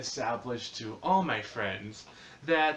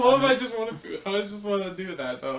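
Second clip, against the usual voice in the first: high pitched and louder; high excited protest; 6 semitones higher, 5 dB louder than usual. Real, not acted. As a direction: squeal it playfully. Playful protest.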